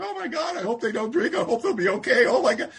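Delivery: high, fretting overwrought voice; mock emotional sponsor. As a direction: ham up a worried, gushing sponsor. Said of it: "get all emotional about sponsoring people".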